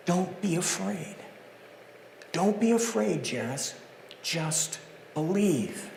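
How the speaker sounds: calm a frightened father gently; reassuring, soft. Acted. Jesus reassuring Jairus.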